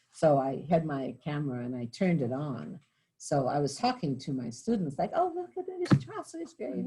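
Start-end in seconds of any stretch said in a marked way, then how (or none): 5.1-6.9 high, eager tour-guide exclaim; mock-thrilled whisper-free gush; excited. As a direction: point it out to the class with delight. Showing students famous graves.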